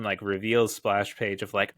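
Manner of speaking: usual speaking voice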